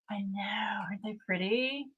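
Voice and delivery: soft voiceless whisper; whispered gush. Real, not acted. Streamer gushing to chat over her pens, whispering. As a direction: whisper it like a secret.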